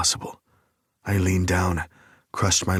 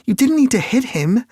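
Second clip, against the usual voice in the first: higher, louder, sharp female challenge; indignant heroine, raised and higher; Lake's voice; 7 dB louder than usual. A narrator's reading. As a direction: whirl on him with an indignant question. Lake scolding Nick.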